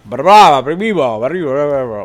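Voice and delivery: loud babble of rapid nonsense syllables; mock fast gibberish Spanish. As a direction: rattle off meaningless sounds like incomprehensible fast Spanish. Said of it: "That's literally what I hear".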